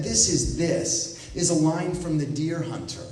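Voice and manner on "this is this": slow, weighty, every word given its own beat; slow deliberate quote. Retold or imitated. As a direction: spell out the monk's riddle slowly and solemnly. Quoting the monk's line.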